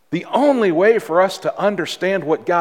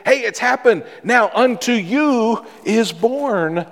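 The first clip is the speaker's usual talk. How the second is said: joyful angel announcement; 7 semitones higher than usual. Acted. Pastor voices the angels telling shepherds, in a joyful ring. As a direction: burst out with the news like a herald angel.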